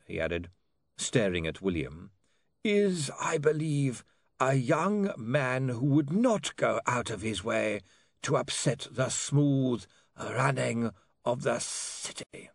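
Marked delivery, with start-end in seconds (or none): 2.6-12.5 slow, high, drawn-out formality; measured lawyer leaning on each phrase; lawyer Slant, pointedly courteous. Acted. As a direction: spell out the veiled warning very slowly. Slant's held character voice.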